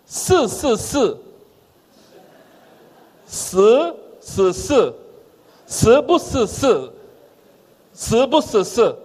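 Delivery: everything turned into "s" in the regional accent; local Chinese accent. Shown as a demonstration. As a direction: show how the locals blur every sound into "s". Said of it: "na minha terra fica assim".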